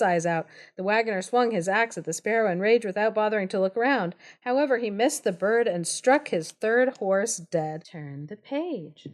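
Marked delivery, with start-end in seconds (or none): none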